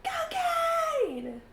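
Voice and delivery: long, drawn-out holler; stretched-out supporter's cry; cheering shout. Acted. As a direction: yell it to spur a racer on. Acts a wife cheering a race.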